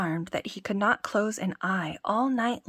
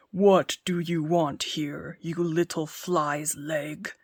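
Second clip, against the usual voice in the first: thundering giant; 3 semitones lower, slower than usual. Acted. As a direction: rumble it down at a tiny intruder. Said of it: "cried the giant, with a voice as if it were thundering".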